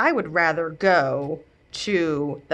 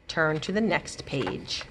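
usual speaking voice

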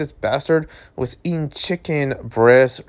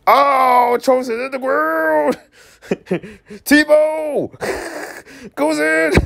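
very high, excited play-by-play shout; mock sports call; 13 semitones higher, slower than usual. Streamer calls the thrown food like football. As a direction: call it like a touchdown commentator.

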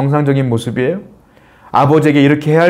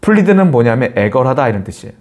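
ordinary talk, nothing marked

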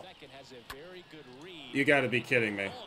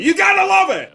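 much higher and louder sarcastic yell; exasperated shout; 12 semitones higher, 7 dB louder than usual. Real, not acted. Gamer shouting at his lined-out hit, sarcastic shout. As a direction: throw it up at the ceiling in frustration. Hard hit caught by fielder.

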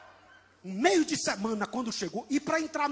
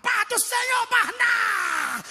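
much higher, louder, drawn-out cry; excited greeting; 11 semitones higher, 5 dB louder, much slower than usual. Retold or imitated. Eager young believer hails Barnabé, excited shout. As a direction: greet him bursting with joy. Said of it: "todo animado".

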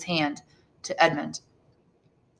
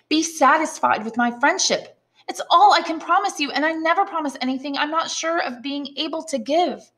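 put-on higher, gentle young woman's voice; voiced Mercedes; 8 semitones higher, 3 dB louder than usual. Mercedes gently refusing her suitor. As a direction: speak as the girl refusing him kindly.

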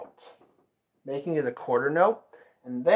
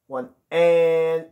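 "One" punched louder than the rest of "One and"; accent on the beat; 2 semitones higher, 8 dB louder than usual. Shown as a demonstration. Teacher shows the band the accent, "One" hit hard. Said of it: "Make sure you bring out that accent there".

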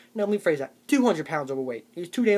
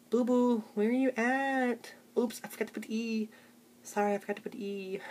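put-on sweet girlfriend texts; 4 semitones higher, 3 dB quieter than usual. Girlfriend texting her boyfriend, high cutesy coo. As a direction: coo the text like a baby-talking girlfriend.

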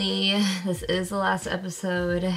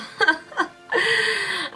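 laughing through tears; 11 semitones higher than usual. Real, not acted. Laughing at her own tears.

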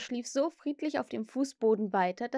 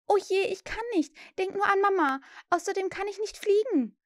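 put-on high, fretful girl's voice; protesting girl; 11 semitones higher, 3 dB louder than usual. A narrator's reading. Wendy refuses Peter's invitation, high and protesting. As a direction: protest anxiously, pitch well up.

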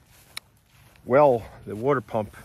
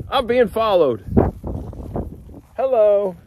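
high, louder cooing; high pet talk; 10 semitones higher, 7 dB louder than usual. Real, not acted. Man cooing at a llama that follows him.

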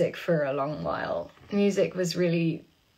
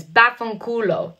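slow, drawn-out Italian curse; Italian accent; 4 semitones higher, 7 dB louder than usual. Shown as a demonstration. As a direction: say it like a real Italian. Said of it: "Can you say something in Italian?".

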